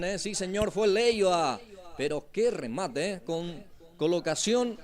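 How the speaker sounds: ordinary talk, nothing marked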